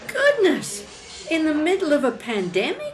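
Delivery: high-pitched astonishment; taken aback by bad news; shocked surprise. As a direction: react with rising disbelief. Hears he was made redundant.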